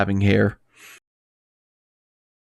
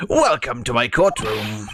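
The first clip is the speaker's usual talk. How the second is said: slow, lordly judge; haughty judge voice; 2 semitones lower, slower than usual. Acted. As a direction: greet him like a smug judge. Voicing the new judge.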